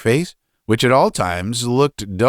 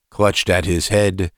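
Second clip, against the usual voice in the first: plain reading voice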